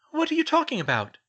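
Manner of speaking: very high, loud incredulous question; baffled question. A narrator's reading. Hermione questioning Harry's odd answer, high loud bafflement. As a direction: pitch it right up in exasperated puzzlement.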